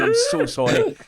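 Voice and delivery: catching himself pointing again, apologises in a squeaky high voice; squeaky mock apology. Real, not acted. High squeaky sorry. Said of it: "I just clocked myself do it again".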